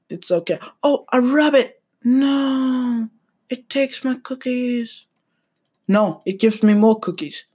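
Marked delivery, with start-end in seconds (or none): 0.8-5.1 surprised cry. Real, not acted. Reacting to his Cookie Clicker game.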